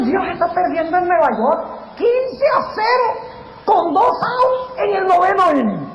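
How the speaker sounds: high-pitched mock despair; defeatist doubter. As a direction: imitate a fan giving up on a hopeless game. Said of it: "muchas personas han hecho así con Dios".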